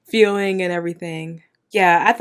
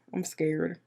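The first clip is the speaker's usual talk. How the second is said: usual speaking voice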